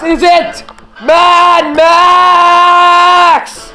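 name drawn out very long; ring announcer intro. Pretend. Announcer revealing the next wrestler to the crowd, drawn-out hype. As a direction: stretch the name into a big slow reveal.

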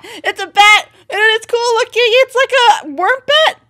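high, loud, thrilled chatter; excited gushing. Real, not acted. Vlogger gushing at the worm bat on screen, high and excited. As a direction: gush over the cute creature. Gushing over the new palisman.

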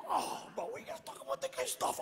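fast, breathy whispered scolding; hushed whisper. Retold or imitated. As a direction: hiss it like a shocked uncle. Uncles hushing him.

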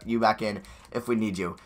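ordinary talk

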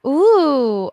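normal talking voice

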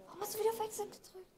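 fast, high exasperated question, a real groan at a teammate; exasperated complaint. Real, not acted. To his teammate who pressed Exit again, fast exasperated scolding. Teammate fumbling the app on stage.

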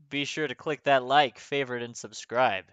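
normal talking voice

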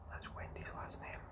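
hushed, voiceless explanation; very soft real whisper; whispered aside. Real, not acted. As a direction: whisper it confidentially to the listener.

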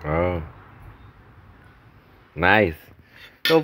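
ordinary delivery

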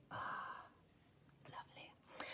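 breathy whispered praise; hushed admiring whisper. Real, not acted. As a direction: whisper it in delight. Whispered appreciation.

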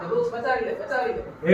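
frightened plea. Acted. Man begs tree to save him.

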